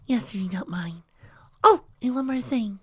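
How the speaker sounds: high, hushed shy pony; timid whisper. A narrator's reading. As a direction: whisper it meekly. Fluttershy whispering.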